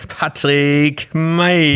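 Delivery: high, slow ring announcer name call; brash fight promoter hype. Acted. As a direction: stretch the name out for the crowd. Boxing intro names the fighter. (Ringsprecher ruft den Namen, hoch und gedehnt)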